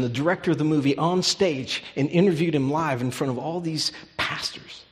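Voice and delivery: loud, rising preacher's cry; fervent preaching. Acted. Pastor to congregation, proclaiming forgiveness, fervent loud preaching. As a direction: preach it out with full conviction.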